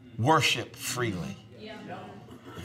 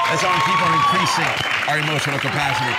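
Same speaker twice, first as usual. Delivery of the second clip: rallying close; 4 dB louder, much faster than usual. Real, not acted. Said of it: "closing rally to the leaders".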